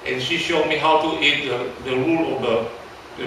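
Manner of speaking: ordinary talk, nothing marked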